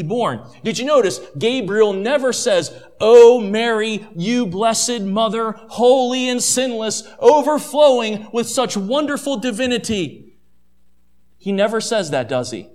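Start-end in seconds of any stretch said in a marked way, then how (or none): 3.0-10.3 hammed-up worshipful address; mock adoration. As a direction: lay on exaggerated praise, loud on the opening. Mock praise Gabriel never spoke.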